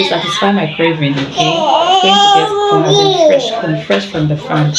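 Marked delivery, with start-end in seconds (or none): 1.1-3.8 fast high fake sobbing; hammed-up crybaby plea; whining through pretend tears; mock wail. Mock crying over corn craving. 3.9-4.7 laughing. Real, not acted. Laughing after mock cry.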